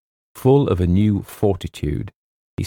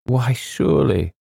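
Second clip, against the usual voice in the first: put-on mildly surprised old colonel, pitch lifted; gently taken aback; 7 semitones higher than usual. A narrator's reading. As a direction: lift the pitch in mild surprise.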